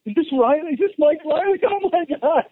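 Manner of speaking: a fan losing it on the phone; overjoyed athlete realizing who is calling; caller going crazy. Retold or imitated. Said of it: "And they start going crazy".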